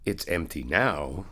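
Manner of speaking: usual speaking voice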